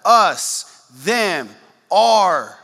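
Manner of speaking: us, them, our each punched loud; stressed words. Shown as a demonstration. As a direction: hit us, them, our one by one. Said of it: "You notice that?".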